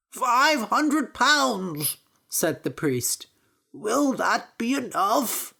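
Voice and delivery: high, slow nervous offer; anxious offer. Acted. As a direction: offer it hoping it's enough. Priest offers money anxiously.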